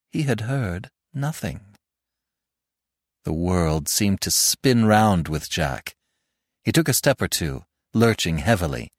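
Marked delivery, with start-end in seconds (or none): none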